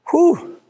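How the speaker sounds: sharp high breathy whew letting off steam; high exhale whew. Real, not acted. Pastor catching his breath after preaching, high whew. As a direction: blow out the excitement in one high whew. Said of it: "I'm getting worked up here".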